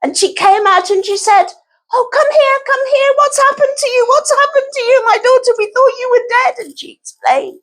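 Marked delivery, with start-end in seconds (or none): 1.9-6.6 much higher, fussing mother; high queen mother voice. Acted. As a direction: call to her, fussing and high.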